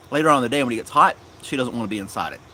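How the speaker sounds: ordinary talk, nothing marked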